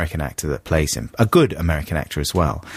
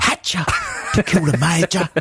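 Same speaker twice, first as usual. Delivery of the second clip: high mock American accent; 10 semitones higher than usual. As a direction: echo the clip in an American accent. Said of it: "He just sounds like he's from America there".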